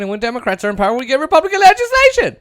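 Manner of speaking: fast, climbing into a high, loud cry; mock exasperation. Host complains who really gets legislation, mock exasperation. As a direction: build to an exasperated squeal.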